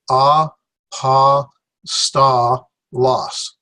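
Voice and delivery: A, pa, sta, los split into separate beats; sounded out syllables. Shown as a demonstration. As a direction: chop A pa sta los into four clear syllables. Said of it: "So there are four syllables".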